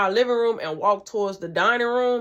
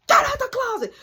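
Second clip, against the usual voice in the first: mock shout; 3 dB louder than usual. Mom shouting at son.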